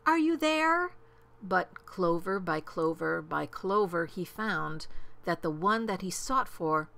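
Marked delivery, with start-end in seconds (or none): 0.1-0.9 high, drawn call; high-pitched searching holler. A narrator's reading. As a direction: call out hopefully for someone lost. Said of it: "picked up and searched them and called".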